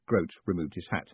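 usual speaking voice

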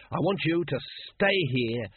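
slow, spaced-out words of an order; slow, weighty briefing to a junior; deliberate instruction. A narrator's reading. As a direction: spell out the duty grandly and slowly. Captain humours the keen recruit.

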